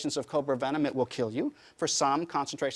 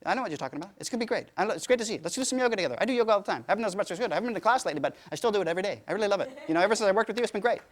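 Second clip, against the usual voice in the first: hyped, rushed, higher voice of a wired friend; Richie's fast talk; 4 semitones higher, much faster than usual. Retold or imitated. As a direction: gush it all out fast and bright like Richie. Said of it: "he talks like this all the time".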